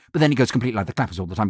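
a fast, crisp voiceover gabble. Shown as a demonstration. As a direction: rattle it off at double speed. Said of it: "'cause I can speak like that".

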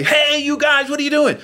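bright hyped-up morning show DJ. Pretend. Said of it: "it's not your morning D J".

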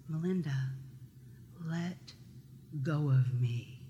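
low, soft, halting words with long pauses; hushed and measured, each phrase set apart; quiet retold plea. Sister in the river asks to be released, quietly.

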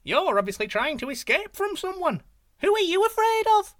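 very high, loud, cheeky monkey voice; teasing monkey. Acted. Monkey teases the fleeing tiger, high and cheeky. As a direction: needle him with a squeaky grin.

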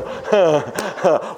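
a burst of real laughter; laughing. Real, not acted. Preacher laughing at his daughter's question. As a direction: break up laughing mid-story.

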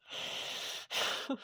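soft, quiet breath of laughter; breathy chuckle. Acted. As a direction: chuckle softly under the breath. Amused in acted dialogue.

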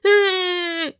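high sustained fake wail; mock cry. Dad copying his crying kids, high mock wail. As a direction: imitate a dad putting on a child's cry. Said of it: "when his kids cry, he goes".

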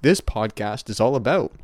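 ordinary delivery

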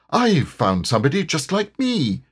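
lightly voiced eager tiger; mildly excited tiger. A narrator's reading. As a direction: announce a discovery with a touch of glee. Tigger delighted at his reflection.

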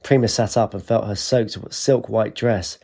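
ordinary delivery, nothing marked

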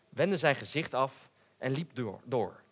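plain reading voice

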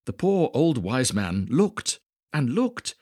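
usual speaking voice

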